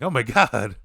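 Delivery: hammed-up appalled outburst; mock shock. Acting out the shocked son.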